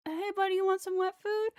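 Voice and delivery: very high, coaxing pet voice; retold pet talk. Owner offering the cat wet food, high pet talk. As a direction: coax a cat in a high singsong.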